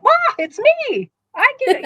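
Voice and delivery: high, loud excited squeal; excited squawk. Retold or imitated. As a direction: burst out squealing with delight. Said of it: "she let out a big squawk".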